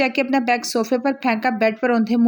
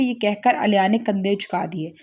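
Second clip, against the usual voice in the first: ordinary delivery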